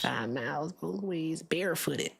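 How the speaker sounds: put-on whispered, slow old man; breathy whispered grandpa storytelling. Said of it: "an old man voice".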